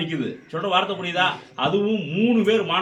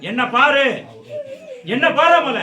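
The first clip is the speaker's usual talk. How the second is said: loud, higher-pitched challenge in short bursts; commanding shout; 6 semitones higher, 6 dB louder than usual. Real, not acted. Deity's medium demands the spirit look and name itself, commanding shout. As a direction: fire out demands to be answered.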